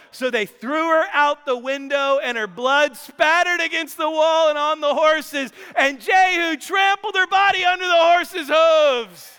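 high, loud climax, building to the end; dramatic storytelling at a yell; shouted narration. A narrator's reading. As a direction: preach it at full force, rising to a shout. Climax of the retold death scene.

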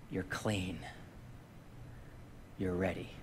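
hushed, reassuring voice of God; gentle assurance. Retold or imitated. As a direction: say it like a quiet blessing. Quoting God's gentle verdict.

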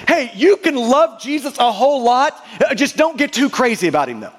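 ordinary talk, nothing marked

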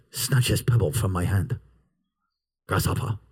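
very low, hushed master's voice; seventies kung-fu master. Retold or imitated. Preacher recalling a seventies show's master to Grasshopper, low imitation. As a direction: intone it low like a wise old teacher. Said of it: "all I can hear is".

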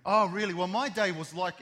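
barrel on about your own day; hammed-up eager neighbour; mock gushing. Self-absorbed friend mimicked.

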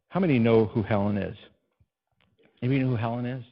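usual speaking voice

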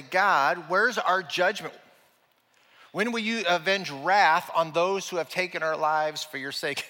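loud pleading cry to God. Retold or imitated. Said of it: "They're crying out to God to say".

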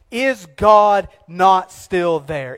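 very slow, weighty question; drawn-out rhetorical plea; slow emphatic question. Acted. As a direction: draw it out for effect.

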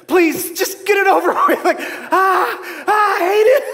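high, laughing mock plea rising to a shout; squealing, laughing ticklish protest. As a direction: beg through giggles to get it over with. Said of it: "I'm just like, like going".

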